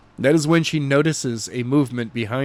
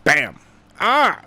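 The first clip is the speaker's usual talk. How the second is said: plain reading voice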